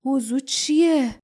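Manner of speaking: faint patient's voice; weak voice. A narrator's reading. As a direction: ask it weakly, drawing the words out. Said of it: "باردا با صدای ضعیفی پرسید".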